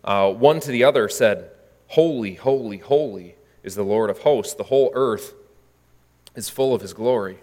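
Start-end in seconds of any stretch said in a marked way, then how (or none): 1.9-7.4 solemn quote. Acted. Seraphim quote read solemnly.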